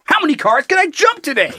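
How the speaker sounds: put-on high, cheeky toddler voice; bratty kid voice. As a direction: play a defiant little brat. Voicing defiant toddler.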